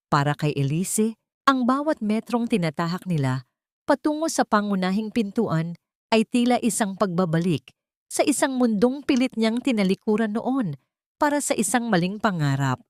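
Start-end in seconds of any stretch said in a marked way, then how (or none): none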